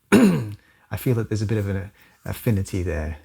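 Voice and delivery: usual speaking voice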